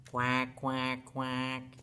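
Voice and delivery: low, level duck quacks; low-pitched imitation of a duck; duck quacking. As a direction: quack three times like a duck. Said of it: "Mother duck is quacking".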